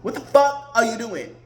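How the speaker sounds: ordinary talk, nothing marked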